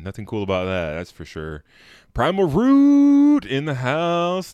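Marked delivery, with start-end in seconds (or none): 2.1-4.5 slow, high, loud hype announcer call. Pretend. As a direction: boom it out like a ring announcer. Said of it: "Waiting for realization".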